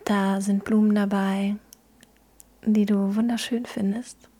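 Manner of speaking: calm, gentle guiding through a visualisation; guided meditation. Acted.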